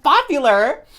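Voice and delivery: high, loud indignant question; insulted disbelief. Retold or imitated. Echoing the guy's word back, loudly insulted. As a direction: shriek it back in mock offence. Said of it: "never felt so insulted".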